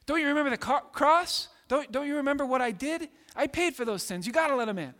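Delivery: high, loud, urgent pleading; Jesus pleading. Retold or imitated. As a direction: beg the Father, rising and insistent. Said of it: "but then Jesus is like".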